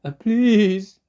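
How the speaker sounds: high, loud wail; wailing plea. Acted. Fox begging the dentist to let him in, high loud wail. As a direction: wail it like a beggar. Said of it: "the fox wailed".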